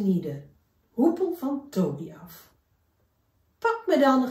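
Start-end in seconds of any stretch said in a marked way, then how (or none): 3.6-4.3 high, loud teasing call; a cheeky little shrew calling out. Acted. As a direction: taunt him playfully, higher and louder. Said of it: "riep Siem".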